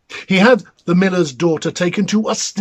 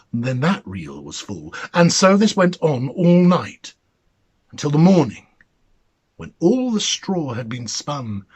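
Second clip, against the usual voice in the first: usual speaking voice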